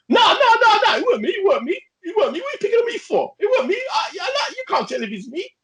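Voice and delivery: very high, squealing mock protest; shrill, frantic imitation of a caught robber; panicked mock denial. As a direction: plead innocence in a hammed-up high whine.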